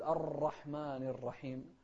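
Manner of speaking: الرحمن with the r rolled too many times; over-trilled ra. Retold or imitated. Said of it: "إذا كررنا الراء زيادة". (تكرير زائد للراء في الرحمن)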